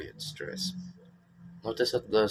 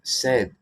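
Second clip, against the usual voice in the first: "said" pitched higher and punched louder; stress on "said"; 5 semitones higher, 6 dB louder than usual. Shown as a demonstration. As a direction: hit the word "said".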